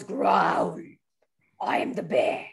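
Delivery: be a bear and growl. Acted. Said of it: "There is a bear".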